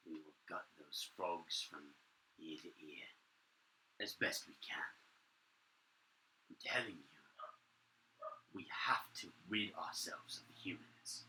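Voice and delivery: hushed, conspiratorial creature voice; whispering conspirator. Acted. Guards plotting against their masters in whispers. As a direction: plot in whispers so no one hears.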